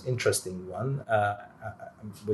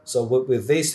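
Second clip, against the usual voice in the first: usual speaking voice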